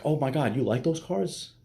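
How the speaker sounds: put-on high, rising incredulous girlfriend; mock appalled. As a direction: act scandalized by his taste. Acting out girl appalled by cars.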